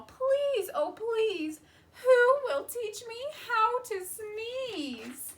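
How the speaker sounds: high, begging little goat voice; pleading goat. Acted. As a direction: beg like a desperate little goat. Goat begs friends for help.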